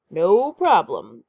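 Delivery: slow, loud monster voice; big lumbering sea monster; booming monster. A narrator's reading. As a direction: boom it out slowly. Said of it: "booms the monster".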